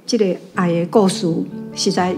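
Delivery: usual speaking voice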